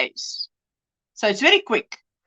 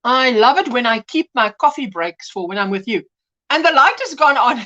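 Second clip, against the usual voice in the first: ordinary delivery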